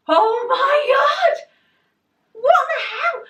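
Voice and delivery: loud, high, drawn-out squeal; utter shock. Retold or imitated. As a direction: stretch out the shocked shriek. Retells shock at the weight.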